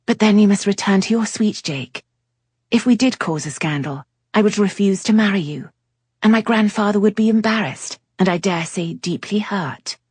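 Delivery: put-on a young lady warning him off a scandal; lightly voiced Cleo. A narrator's reading. Cleo voiced, louder and higher.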